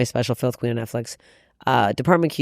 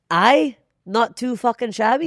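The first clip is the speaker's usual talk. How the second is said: higher, slow British accent; put-on British accent; 7 semitones higher, slower than usual. Shown as a demonstration. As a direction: stretch it out in a playful British accent. Continuing the accent demo.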